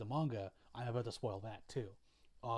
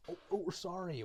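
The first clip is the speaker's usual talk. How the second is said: high-pitched imitated apology; mock apology; 7 semitones higher than usual. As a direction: play a placating family, pitched up. Family apologizes to raging dad.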